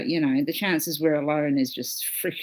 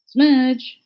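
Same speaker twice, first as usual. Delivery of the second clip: loud, high call for the cat; 8 semitones higher, 9 dB louder than usual. Real, not acted. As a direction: shout "Smudge!" high and loud. [name]'s calling you.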